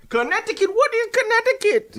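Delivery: put-on high, incredulous Jamaican-accented squeal; mock disbelief; feigned bafflement. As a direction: act as if the word is foreign. Feigns never hearing of home state.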